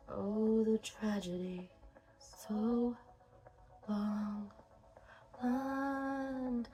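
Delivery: murmured lyric. Acted. Murmured lyrics along with song.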